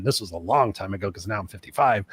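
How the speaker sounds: usual speaking voice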